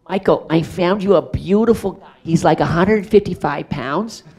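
high, loud imitation of an enthusiastic casting agent; casting woman's pitch. Casting woman pitching her find to the director, enthusiastic imitation. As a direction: talk up your find, mimicking her loudly.

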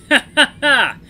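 big loud booming ha ha ha; hearty laugh. Acted. Elder wolf laughing at the pie deal, loud hearty laugh. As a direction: let the old wolf roar with laughter. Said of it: "The older male laughs loudly".

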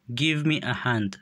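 each word said slowly and distinctly for learners; slow and clear. Shown as a demonstration. Teacher models English phrase for learners, slow and clear. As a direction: model the phrase slowly so students can copy it. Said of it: "به خاطر آموختن تلفظ درستشان".

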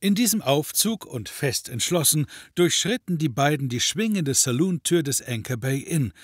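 ordinary delivery

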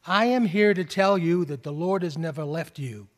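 normal talking voice

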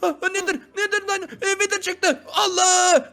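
high-pitched, frantic repeated cries; panicked shock. Pretend. Player attacked by a Wither, high panic. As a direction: cry out high in play terror.

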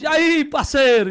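loud, high, drawn-out hail; mock-friendly shouted greeting; hearty greeting. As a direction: holler it to a buddy, stretched out. Demonstrates insult as friendly greeting.

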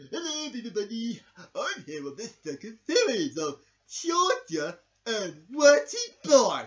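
slow, loud presenter announcement; show host intro. Acted. Host welcoming everybody to the new series.

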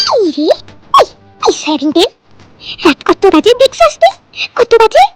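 high, sharp scolding at night; scolding father. Acted. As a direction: tell him off for the late hour. Father scolds late-night phone chatter. (রাগী বাবার বকুনি)

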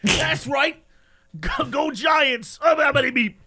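high, shouted New York sports fan; New York guy. Acted. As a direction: cheer in a hammed-up New York accent. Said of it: "my New York guy".

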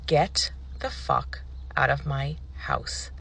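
very slow, word-by-word command; every word separated, softly forceful; firm repeated order. Retold or imitated. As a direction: spell it out with quiet, low finality.

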